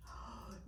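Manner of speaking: breathless gasp of wonder; unvoiced gasp of delight; soft gasp. A narrator's reading. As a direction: catch your breath at the stars. Raccoon reaches the stars.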